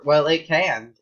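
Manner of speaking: normal talking voice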